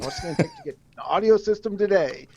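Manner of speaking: lightly hammed car salesman pitch; slick used car salesman. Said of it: "Yep, I sound like a car salesman".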